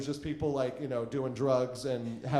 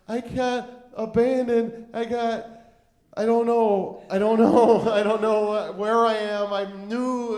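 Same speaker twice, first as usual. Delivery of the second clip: higher pitched, slow, frantic "I don't know"; panicky lost plea; 5 semitones higher, 5 dB louder, slower than usual. Retold or imitated. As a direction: sound stranded and helpless, half laughing. Pleading with Barry on the street.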